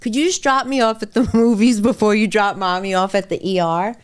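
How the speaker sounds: put-on breezy child wanting the movies first; a hint of a little girl's voice; daughter's casual request. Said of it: "my daughter Sophia was like".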